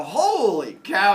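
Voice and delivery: slow, cheerful "holy cow" with a rising tune; the good-news version of the cry; happy holy cow. Retold or imitated. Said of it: "That's not a good sound".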